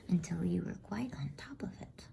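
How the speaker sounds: usual speaking voice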